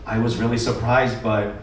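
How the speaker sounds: ordinary talk, nothing marked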